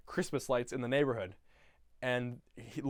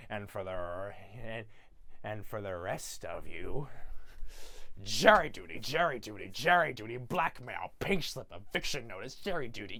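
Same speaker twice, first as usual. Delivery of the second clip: Grinch; 6 semitones lower, 4 dB quieter than usual. Retold or imitated. Grinch messing with people's mail, low mischievous mock voice. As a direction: play a low, scheming mail-sorter relishing each item. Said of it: "and then he goes".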